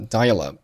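dial-up with lips closed silently on p; dial-up with a swallowed final p; unreleased p. Shown as a demonstration. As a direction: end dial-up without popping the p. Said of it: "dial-up结尾的p并没有爆出来".

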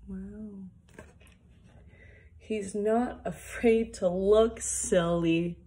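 ordinary delivery, nothing marked

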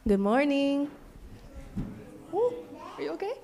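usual speaking voice

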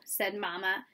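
ordinary delivery, nothing marked

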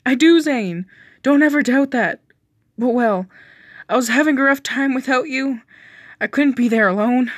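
usual speaking voice

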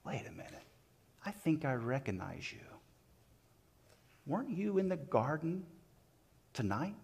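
hushed, halting suspicion; puzzled recognition. Retold or imitated. Bystander squinting at Peter in dim firelight, puzzled recognition. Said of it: "He's trying to figure out".

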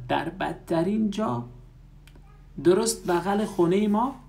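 shocked: right next to our house?; surprised outcry. A narrator's reading. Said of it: "ملانی با تعجب فریاد زد".

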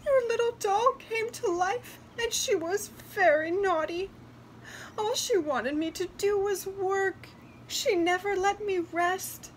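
put-on high, plaintive little-girl voice; tearful. A narrator's reading. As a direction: complain tearfully to grandmother. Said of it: "sobbed Natasha".

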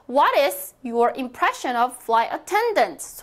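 slow, clear model sentence for repeating; teacher reading aloud slowly for practice. Shown as a demonstration. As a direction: read it slowly so students can follow. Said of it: "소리 크게 내어주세요".

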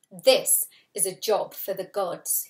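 loud stern scolding. Acted. God scolding the children, stern and loud. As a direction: tell them off from the cloud. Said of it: "he scolded".

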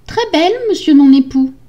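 high, dutiful wife voice; meek wife. Acted. Wife reporting to her husband, high meek voice. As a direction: answer high and obediently.

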